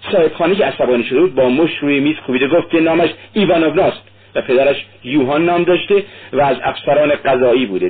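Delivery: ordinary delivery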